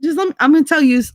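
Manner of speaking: rushed and high, building up; fast excited rant. Retold or imitated. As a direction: rattle it off fast. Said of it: "my version of".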